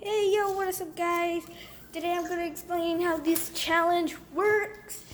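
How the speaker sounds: high, upbeat YouTube hello; excited vlogger greeting. Acted. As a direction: greet your viewers with energy. Vlog greeting.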